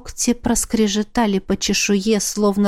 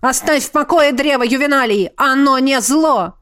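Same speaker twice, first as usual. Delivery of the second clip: pleading shout; 4 semitones higher, 3 dB louder than usual. A narrator's reading.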